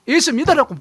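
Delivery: loud, high barked orders; angry scolding. Retold or imitated. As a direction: scold the grandkids into church. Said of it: "성기를 내면서 말해요".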